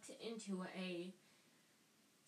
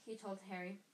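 usual speaking voice

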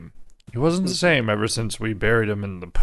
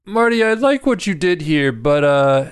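mock film director; 6 semitones higher than usual. S1 voices a film boss to Marty.

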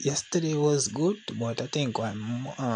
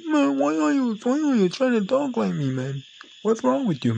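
high, strained mock voice of an annoyed coworker; high-pitched parody of an irritated coworker; 12 semitones higher, 4 dB louder than usual. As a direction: parody him getting vexed. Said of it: "me mock them".

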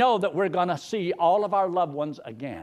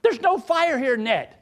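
retold protest; 7 semitones higher, 4 dB louder than usual. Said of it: "And I says".

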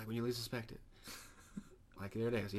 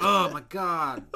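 drawn-out loud wail of disbelief; mock outraged cry; 6 semitones higher, 14 dB louder, much slower than usual. Retelling the game show answer, loud mock anguish. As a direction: ham up the shock, slow and loud.

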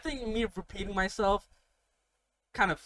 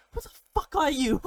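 high, loud mock outrage; retold shouting back; 8 semitones higher, 5 dB louder than usual. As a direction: throw the challenge back, raised and incredulous. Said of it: "and I keep shouting back".

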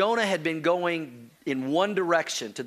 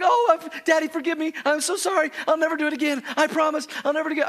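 put-on high, pleading little boy begging forgiveness; pleading child; 10 semitones higher, 3 dB louder, faster than usual. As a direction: beg Daddy like a sorry child. Crying son begs forgiveness.

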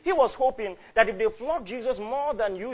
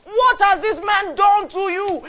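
high, full-force shout; very loud, high-pitched outcry; crying out; 14 semitones higher, 15 dB louder than usual. Acted. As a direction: shout a protest over a hostile crowd. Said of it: "cried out".

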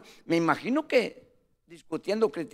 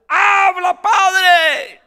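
full-force, high-pitched yell; crowd shouting; 12 semitones higher, 15 dB louder, much slower than usual. Retold or imitated. Congregation outside shouting to the preacher during translation, full shout. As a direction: cry out loudly from the crowd, voice way up. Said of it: "la gente gritaba allá afuera".

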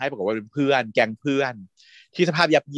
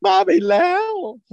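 very high, loud squeal of delight; excited squeal; 5 dB louder than usual. Real, not acted. Host swooning over the actor's line, very high loud squeal. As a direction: shriek it like a swooning fan.

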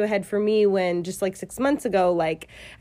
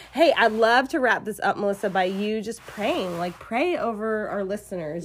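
normal talking voice

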